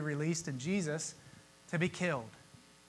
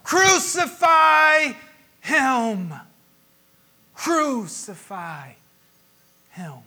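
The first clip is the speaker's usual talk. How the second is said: slow, drawn-out crowd yell, higher and louder; 6 semitones higher, 8 dB louder, much slower than usual. Acted. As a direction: bellow it like a mob. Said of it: "the people yell".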